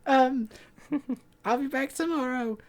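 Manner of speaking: imitating a voice worn high after a long stream; high tired voice. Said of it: "at the end of it, I'm like".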